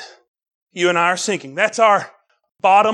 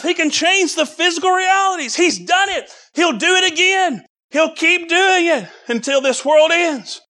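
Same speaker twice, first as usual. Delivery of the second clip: normal talking voice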